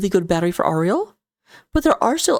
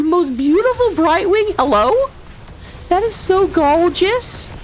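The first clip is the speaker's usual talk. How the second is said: gushing; 8 semitones higher, slower than usual. Real, not acted. Admiring the hero skin.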